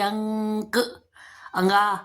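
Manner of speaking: अंक then अंगा with the ng sound held; nasal ng demo. Shown as a demonstration. Teacher to children, showing the ng sound in अंक. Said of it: "अंगा की ध्वनि निकल रही है".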